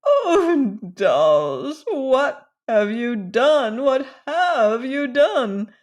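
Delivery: a hammed-up old lady's lament; moaning despair. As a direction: moan it out as if all is lost. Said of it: "gave a moan of despair".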